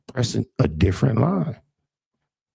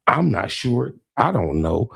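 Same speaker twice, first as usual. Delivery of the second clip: ordinary delivery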